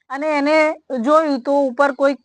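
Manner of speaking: ordinary delivery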